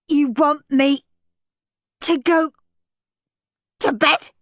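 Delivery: high, loud, halting words with long gaps; stuttering in disbelief. A narrator's reading. Henry told it's grown-ups only, stuttering. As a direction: break it up, too shocked to go on. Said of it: "Henry stuttered".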